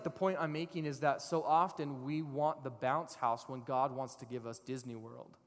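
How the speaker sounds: ordinary talk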